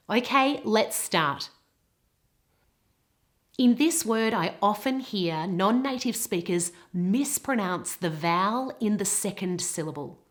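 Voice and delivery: normal talking voice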